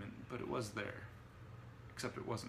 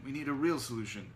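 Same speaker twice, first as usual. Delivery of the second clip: raised, impatient insistence; growing irritation; 4 semitones higher, 5 dB louder than usual. A narrator's reading. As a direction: press the point with mounting annoyance. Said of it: "in growing irritation".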